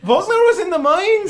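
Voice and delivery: high, loud, incredulous question; astonished shopkeeper. Acted. Shopkeeper hearing about Volkner, high astonished voice. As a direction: blurt it out high and loud in disbelief.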